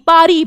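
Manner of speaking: पारी said as pa-ree with no final s; Paree not Paris. Shown as a demonstration. Teacher drilling पारी to kids, silent s. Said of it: "पेरिस नहीं बोला".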